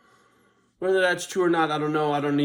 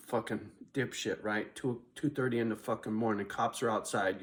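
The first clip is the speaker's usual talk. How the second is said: ordinary talk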